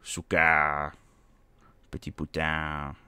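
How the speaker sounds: low, mumbled mock French accent. Gamer joking about the game's French crowd, low mock French accent. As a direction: toss off lazy fake French accent. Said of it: "everybody was talking like this".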